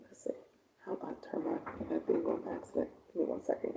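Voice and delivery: quick whispered aside. Real, not acted. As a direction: whisper it off-mic, under breath. Aside while fixing her phone.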